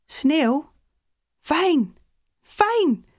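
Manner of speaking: high, gleeful cries, climbing each time; delighted exclaiming. A narrator's reading. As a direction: exclaim with rising schoolboy delight. Boys delighted by the snow. (verrukt, steeds hoger uitgeroepen)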